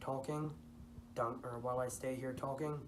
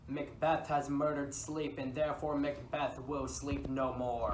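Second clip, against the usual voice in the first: louder, higher haunting cry; 3 semitones higher, 7 dB louder than usual. Retold or imitated. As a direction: call it out like a voice in the night. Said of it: "The voice kept crying".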